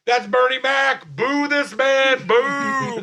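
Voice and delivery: high, loud, drawn-out booing; mock heckling. Imagined audience member outs a joke thief, heckling loudly. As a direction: call it out slowly from the crowd.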